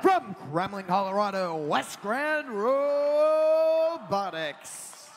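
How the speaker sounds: loud team introduction with the name stretched out; arena announcer. Real, not acted. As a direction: boom it out, dragging the last word.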